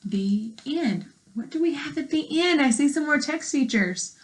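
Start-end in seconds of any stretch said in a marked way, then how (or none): 0.0-1.0 clear, even read-aloud voice for a class; reading to children. Acted. Teacher reading a frog book to her class, clear read-aloud.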